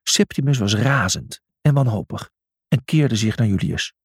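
usual speaking voice

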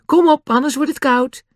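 high-pitched urging call. A narrator's reading. Annie urges the others to come eat, calling out. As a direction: hurry them along with a high call. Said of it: "riep ze".

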